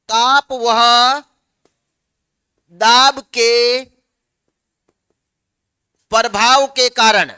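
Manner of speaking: very slow, drawn-out dictation; dictating. Real, not acted. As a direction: dictate word by word, stretching the syllables. Teacher dictating for students to write.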